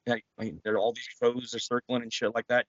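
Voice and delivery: ordinary talk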